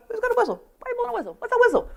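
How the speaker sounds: high-pitched mock bystander chatter; curious bystanders. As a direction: imitate puzzled onlookers in a high little voice. Imitates bystanders hearing a whistle.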